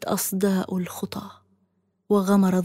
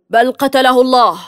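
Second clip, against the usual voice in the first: louder, higher, a raging cry; furious shout; 4 semitones higher, 12 dB louder than usual. A narrator's reading. The governor shouting back at the captive in rage. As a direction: bark it out in spite. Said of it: "فصرخ بغيظ".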